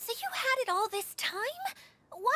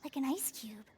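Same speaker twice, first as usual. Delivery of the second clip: low, soft murmur, almost a whisper; hushed aside; 8 semitones lower, 6 dB quieter than usual. Acted. Paimon murmurs privately about the dancer, hushed whisper. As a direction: muse quietly to yourself, half-whispering.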